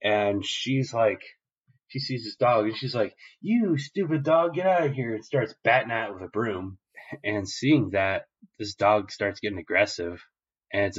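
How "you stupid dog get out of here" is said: a high-pitched little girl's scolding, lightly voiced; mock scolding yell. Little girl yells at a stray dog, mock-angry and high-pitched. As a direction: shoo the dog off with a squeaky scold.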